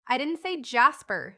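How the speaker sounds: put-on emphatic little child's denial. A narrator's reading. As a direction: push out "I didn't say Jasper" emphatically. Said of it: "she repeated emphatically".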